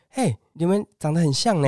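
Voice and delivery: imitating a saleswoman yelling after them; mock yell. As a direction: shout it across the shop. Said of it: "有点类似类咆哮那种感觉，就是很大声说".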